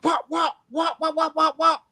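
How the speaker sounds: high repeated wop sound effect; playful sound effect for beating someone; imitated whacks. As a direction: mimic the blows with a high pitched wop wop. Imitating hitting someone with stick.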